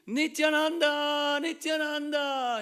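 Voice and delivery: long, high, very loud drawn-out cry; ecstatic shout. Acted. Devotee waking from his dream, crying out in ecstasy. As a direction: shout it out in rapture, every name stretched. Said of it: "he would shout in ecstasy".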